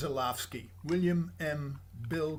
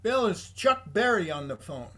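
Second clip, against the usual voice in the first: a retold call across the office, louder; mock shout around the corner; 5 semitones higher, 9 dB louder than usual. Receptionist calling the lawyer to the phone, retold, louder. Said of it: "she shouts out to Bill".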